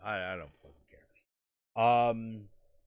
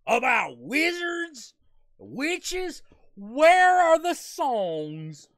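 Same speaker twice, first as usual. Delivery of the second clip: much higher, louder, slow indignant shouting; hammed-up furious shouting preacher; outraged preacher; 10 semitones higher, 11 dB louder, slower than usual. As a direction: rail at the film like a scandalized televangelist. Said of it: "Tom Kenny of SpongeBob fame going".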